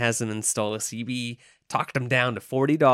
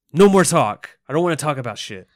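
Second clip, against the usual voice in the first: acting out an angry, raised-voice refusal; loud, higher-pitched retold blow-up, easing at the end; pissed-off outburst; 4 semitones higher, 7 dB louder than usual. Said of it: "this pisses Lewis off".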